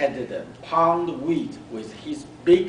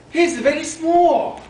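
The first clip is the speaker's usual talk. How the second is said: mom's voice; 12 semitones higher, 8 dB louder than usual. Acted. Mother comments on tiny snail.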